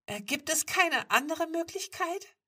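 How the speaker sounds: high, loud, whining plea; acted whiny, pleading voice. A narrator's reading. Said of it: "dass ihre Stimme weinerlich klang".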